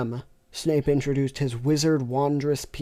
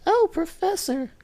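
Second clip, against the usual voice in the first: moaning lustful voice. Acted. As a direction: play her falsetto and swooning. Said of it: "said Lala with a moaning, lustful voice".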